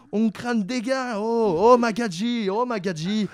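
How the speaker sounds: put-on high, drawn-out Marseille accent; over-the-top Marseillais. Debater quoting Marseille slang, overdone Marseille accent. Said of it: "un Marseillais qui en fait trop".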